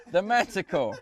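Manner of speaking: shouting at software. Real, not acted. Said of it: "I have to shout at this thing to start work".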